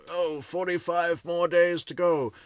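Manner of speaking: high, loud eager fan voice; eager countdown voice. Retold or imitated. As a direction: play a giddy fan counting down. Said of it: "Everyone was like".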